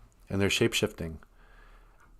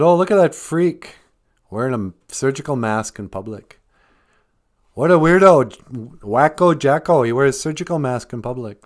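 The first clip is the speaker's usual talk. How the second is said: mocking jeer; 4 semitones higher, 6 dB louder than usual. Mocking crowd jeering at Jackson.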